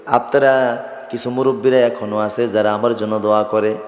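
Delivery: ordinary talk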